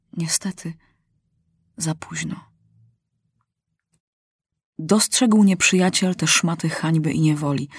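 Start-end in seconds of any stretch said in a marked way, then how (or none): 0.1-2.5 hushed, breathy whisper of regret; soft grieving whisper. A narrator's reading. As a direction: drop to a whisper, as if too late. Narrator grieves lost chance.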